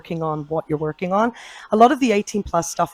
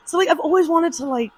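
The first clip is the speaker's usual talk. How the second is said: normal talking voice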